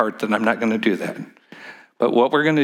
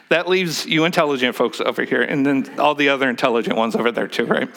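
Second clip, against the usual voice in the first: normal talking voice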